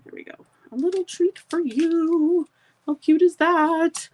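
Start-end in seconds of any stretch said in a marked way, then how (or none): 0.7-2.5 sweet sing-song. Shown as a demonstration. Rereads saying in sweet voice. 2.9-4.1 delighted gush. Real, not acted. Admiring finished card.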